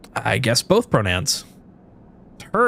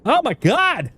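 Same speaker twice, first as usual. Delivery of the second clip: high, exasperated shout of disbelief; frustrated outburst; 12 semitones higher, slower than usual. Real, not acted. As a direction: blow up at the bad luck. Roll fails, frustrated outburst.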